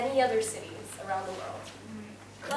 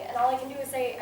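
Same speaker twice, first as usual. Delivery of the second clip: ordinary talk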